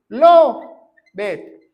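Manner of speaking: "Lo bet" broken in two, first syllable loud, then a pause; exaggerated syllables. Shown as a demonstration. Preacher showing the English past tense, "Lo bet" split into syllables. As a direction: punch "Lo", wait, then add "bet" separately.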